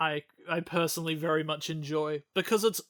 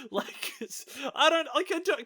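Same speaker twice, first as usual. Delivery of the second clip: ordinary talk, nothing marked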